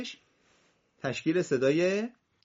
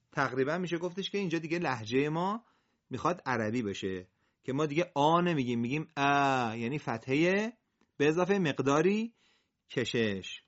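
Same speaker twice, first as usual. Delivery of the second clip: ordinary delivery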